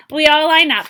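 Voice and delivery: ordinary delivery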